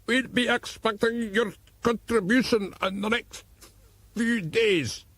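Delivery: slow, put-on Irish accent, menacing caller; mock Irish accent. A narrator's reading. Lockhart threatens O'Brien by phone in a put-on Irish accent. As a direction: fake Irish terrorist on the phone. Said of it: "in a supposedly Irish voice".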